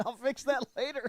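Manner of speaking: repeating it through a laugh; laughing echo. Retold or imitated. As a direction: laugh while you echo it. Echoing friend's quote, laughing.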